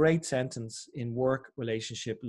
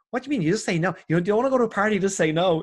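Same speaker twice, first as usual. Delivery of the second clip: higher, amused disbelief; Sarah Knight, incredulous; 5 semitones higher, 5 dB louder, faster than usual. Retold or imitated. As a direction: mimic her baffled laughter at the Irish.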